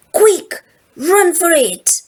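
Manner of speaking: high, loud urgent cry to flee; panicked urge. A narrator's reading. Morten telling friends to flee, panicked cry. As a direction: yell at friends to run away. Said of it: "And Morton says".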